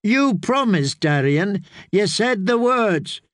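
high, insistent reproach; indignant pleading Sam. Acted. Sam blocks his friend's way, high indignant reproach. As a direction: hold him to his vow, urgently.

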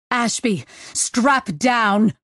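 understated yell; yelled order. Acted. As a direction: call out a quick warning, held back. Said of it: "Sisseks yelled".

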